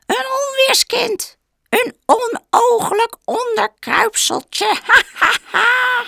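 very high shrieking witch cackle; hag. Acted. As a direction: screech like a mocking witch. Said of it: "krijsten de heksen".

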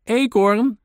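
high, loud hail; calling out. A narrator's reading. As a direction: shout for a friend over the fence. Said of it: "riep de mier even later".

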